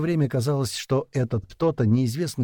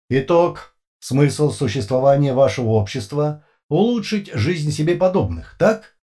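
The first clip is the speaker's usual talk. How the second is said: loud, higher emotionless questioning; metallic voice; 5 semitones higher, 5 dB louder than usual. A narrator's reading. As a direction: read it like a questionnaire. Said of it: "совершенно без пауз и эмоций".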